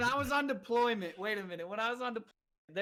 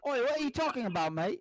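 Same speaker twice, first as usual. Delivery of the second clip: British accent; 3 semitones higher than usual. Shown as a demonstration. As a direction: put on a cheeky Brit. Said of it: "let me hear the Brit in you".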